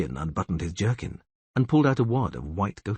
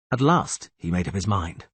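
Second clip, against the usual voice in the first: plain reading voice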